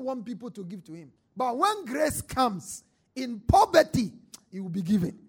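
loud, emphatic sermon shouts; fiery preaching. Acted. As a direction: preach it hard to the crowd. Preaching at full force.

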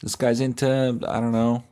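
usual speaking voice

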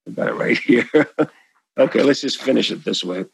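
usual speaking voice

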